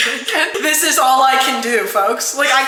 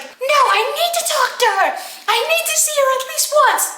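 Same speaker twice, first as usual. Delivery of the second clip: put-on high, pleading girl voice; girl begs her mom to see her; desperate plea. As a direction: insist in a much higher, desperate voice. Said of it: "She's just like".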